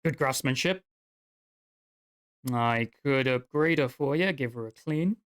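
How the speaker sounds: a hint of an appraising old craftsman; lightly voiced dwarf smith. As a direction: admire the work like an old smith.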